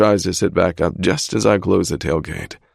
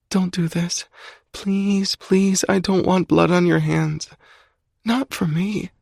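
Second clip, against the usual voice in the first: high female voice, pleading; girlfriend pleading; 13 semitones higher, slower than usual. A narrator's reading. Alex begging Zai to stop, high female plea. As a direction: beg him to stop in her higher voice.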